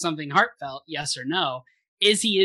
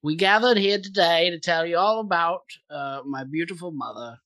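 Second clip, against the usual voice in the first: mock funeral speech in British accent; formal, hammed-up British eulogy; 2 semitones higher than usual. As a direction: deliver a eulogy as a put-on Brit. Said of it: "talking like a Brit".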